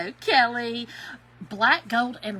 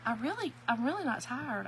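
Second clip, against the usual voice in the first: ordinary talk, nothing marked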